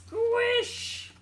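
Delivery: high, squeaky squish; comic high squelch sound; squish noise. Acted. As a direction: make the squelch of stepping in it. Pete steps in blueberries.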